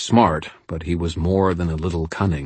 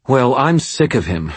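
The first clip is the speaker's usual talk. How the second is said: higher, contemptuous female voice; spat out; 6 semitones higher than usual. Acted. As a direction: spit the words out. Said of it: "spat Madeline".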